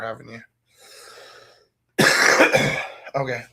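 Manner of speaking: ordinary talk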